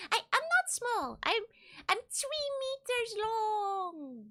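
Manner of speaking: high, loud, slow indignant protest; mock offended. Streamer protests to chat calling her small, mock offended. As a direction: protest like an insulted tiny mermaid. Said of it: "mock offended mermaid".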